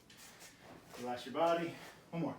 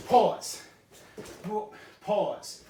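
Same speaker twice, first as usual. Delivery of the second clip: called pause; 3 semitones higher, 5 dB louder, much slower than usual. Shown as a demonstration. Sensei calling the pause loudly to students. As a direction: Pause called out loud and sharp. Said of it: "think about that timing".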